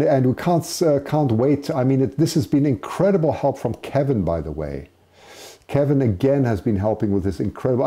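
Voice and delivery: ordinary talk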